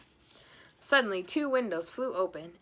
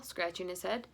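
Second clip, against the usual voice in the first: ordinary delivery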